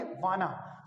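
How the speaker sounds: "वाना" said wah-nuh, no t; British-style "वाना", wah-nuh; want as wanna. Shown as a demonstration. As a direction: drop the t: "वाना". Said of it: "उसको हम British accent में बोलेंगे वाना".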